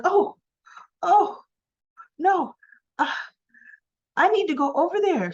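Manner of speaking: put-on high, fast, anxious girlfriend voice; a flustered pitch-up as Abby; Abby's voice. Girlfriend wanting to break up the fight, high anxious voice.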